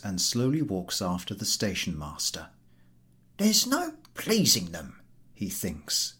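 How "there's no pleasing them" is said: baffled, higher grumble; puzzled at their ingratitude; bewildered. Acted. Podyakin thinking to himself, bewildered.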